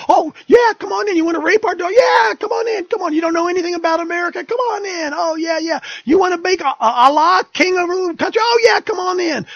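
high, loud mocking sing-song of a naive church; sarcastic mock welcome. Preacher mocking a church that welcomes everyone, high sarcastic gushing.

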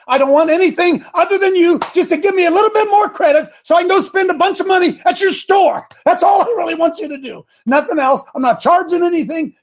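losing patience with the phone agent, voice climbing; exasperated yelling. Retold or imitated. Arguing with credit card support.

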